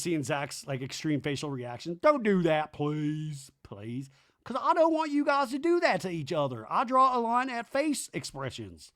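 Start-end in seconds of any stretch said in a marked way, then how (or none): 2.0-8.9 high, whiny imitation, dropping on the pleases; mock pleading. Brittany begging Zach to stop, high mock plea. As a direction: plead in a put-on voice.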